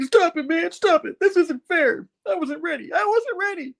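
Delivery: a sulky villain whining he wasn't ready; whiny villain. Retold or imitated. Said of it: "He's like".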